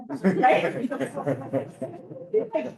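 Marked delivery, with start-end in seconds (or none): 0.9-2.5 breathy unvoiced laugh. Real, not acted. Laugh after sister's answer.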